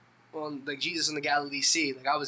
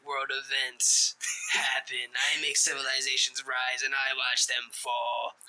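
slow, grand, ominous boast; slow grandiose villain; slower than usual. Pretend. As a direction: savour each word like a mastermind.

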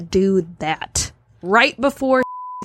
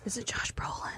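breathy secret whisper; 19 dB quieter than usual. Real, not acted. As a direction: whisper the guess. Guessing quietly across the table.

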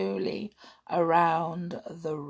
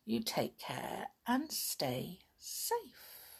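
slow, gentle whisper; soft whisper; 3 semitones higher, 10 dB quieter, slower than usual. Real, not acted. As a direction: tuck them in softly. Tender sign-off.

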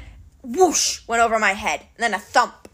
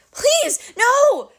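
high, loud begging wail; pitiful giant begging; pitiful moan; 13 semitones higher, 4 dB louder than usual. Acted. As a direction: beg for mercy from below the sword. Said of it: "the Cyclops moaned".

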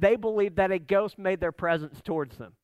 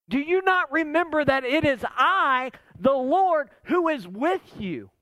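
high, emphatic preaching questions; Jesus insisting; 6 semitones higher than usual. Acted. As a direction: press each question home with authority.

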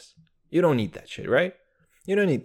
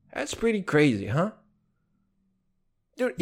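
ordinary talk, nothing marked

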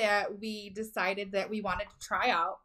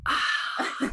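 long breathy exaggerated sigh; loud sigh; 5 semitones higher, 6 dB louder than usual. Real, not acted. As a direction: let out a big audible sigh. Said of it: "a nice loud sigh".

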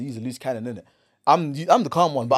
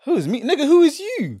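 high-pitched retold retort; mock-indignant challenge; indignant comeback; 10 semitones higher than usual. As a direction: throw the question back, pitch leaping up. Retelling his indignant comeback.

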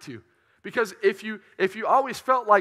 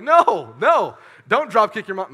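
mock scolding; 3 semitones higher, 9 dB louder, slower than usual. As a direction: shout it down like scolding yourself. Scolding himself, loud.

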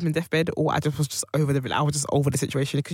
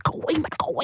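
high, breathy hiss to go away; whispered go away; 12 semitones higher, faster than usual. Retold or imitated. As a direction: shoo him off in a whisper. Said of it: "I was like".

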